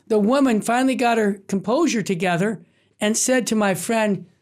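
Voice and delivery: ordinary talk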